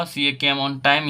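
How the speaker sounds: slow, word by word, like dictating to a class; slow dictation. Shown as a demonstration. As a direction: spell the sentence out slowly for students. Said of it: "दोस्तों यहाँ बताइए यहाँ पे क्या होगा".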